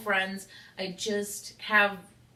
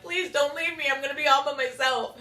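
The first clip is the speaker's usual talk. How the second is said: high, whiny mock plea; mock pleading; 8 semitones higher, 5 dB louder than usual. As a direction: beg like a clingy kid, pitched up. Begging friend not to leave.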